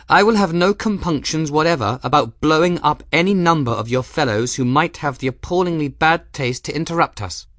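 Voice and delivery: high, loud imperious noblewoman; furious commanding lady's voice; grand hollywood dame. Acted. Gwen's imperious voice.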